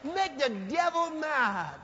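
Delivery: preacher shouts a slow warning; slow preaching shout. Acted. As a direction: spell it out as a warning. Preacher's exclamation.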